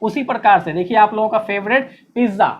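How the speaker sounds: normal talking voice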